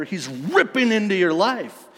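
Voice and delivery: loud, high, impassioned declaration; forceful preaching. Real, not acted. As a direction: burst out at full preaching force. Preacher surges against the weak image.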